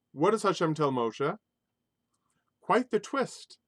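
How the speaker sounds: usual speaking voice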